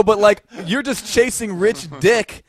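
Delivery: loud, high, drawn-out rant; retold scornful telling-off. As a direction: spell it out to her, slowly and loudly. Retold argument with a girl.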